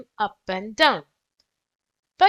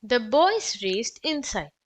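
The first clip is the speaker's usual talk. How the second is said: ordinary delivery, nothing marked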